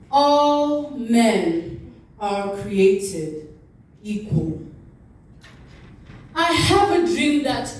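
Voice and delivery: ordinary delivery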